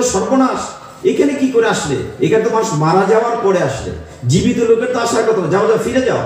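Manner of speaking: high, fast, startled Yamaraj; startled king of death sending the boy home, high and fast; alarmed god of death. Acted. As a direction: shoo the living boy away in a high, hurried alarm.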